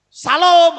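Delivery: Salom loud and heavy, Javanese-accented; thick Javanese accent. Shown as a demonstration. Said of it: "aku kok medok banget gitu". (Salom dengan logat Jawa medok, keras)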